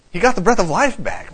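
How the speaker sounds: usual speaking voice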